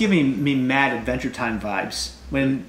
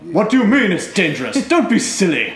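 Onion King voice; 5 semitones higher, 3 dB louder than usual. Acted. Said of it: "Are you just gonna voice all of the Onion King".

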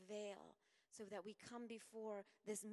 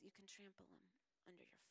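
fast whisper; 4 semitones lower, 13 dB quieter, much faster than usual. Acted. Jesus quoted, fast whisper.

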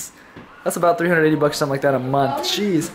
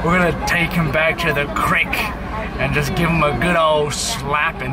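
Texan accent; 4 semitones lower than usual. Shown as a demonstration. Showing a friend what a Texan sounds like, Texan accent. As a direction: put on a low cowboy Texan accent. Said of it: "That's my Texan accent".

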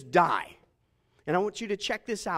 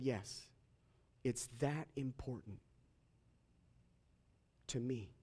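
low, hushed, with long pauses; soft voice; 6 semitones lower, 11 dB quieter than usual. Acted. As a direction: answer tenderly and very quietly. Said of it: "in a soft voice".

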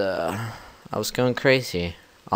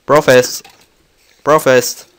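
loud catchphrase; 6 semitones higher, 13 dB louder than usual. Real, not acted. Channel catchphrase.

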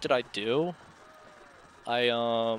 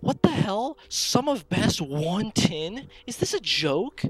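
stunned disbelief; 11 semitones higher, 6 dB louder than usual. Real, not acted. Disbelief at a record run.

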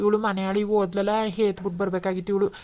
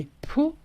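disgusted spit; 9 semitones higher than usual. Acted. Spitting in disgust at daughter.

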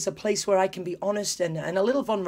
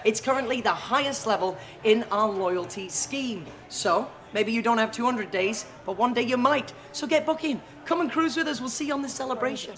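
bright, higher hosting voice; upbeat cruise director; 8 semitones higher than usual. Real, not acted. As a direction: hype up the crowd like a host. Hosting diamond guests on camera.

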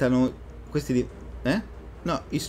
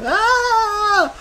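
meme shriek; 5 dB louder than usual. Retold or imitated. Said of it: "Quel meme?".

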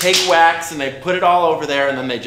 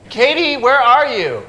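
calling out; 7 semitones higher, 5 dB louder than usual. Acted. Calling out for friend on set.

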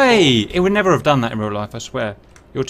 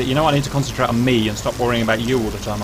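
ordinary delivery

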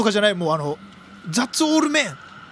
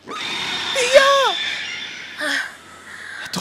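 long high scream; much slower than usual. Real, not acted. Reaction to dangerous game moment.

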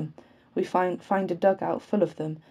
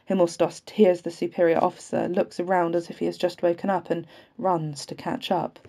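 ordinary delivery

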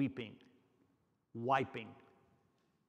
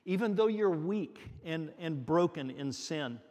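usual speaking voice

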